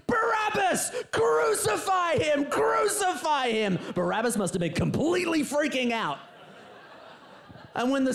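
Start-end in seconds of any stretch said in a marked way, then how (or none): 0.1-3.8 high, loud, slow mob chant of Crucify; crowd shouting. Retold or imitated. Crowd heard from the dungeon, shouting for crucifixion. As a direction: roar it like a distant mob. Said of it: "what's the crowd shouting".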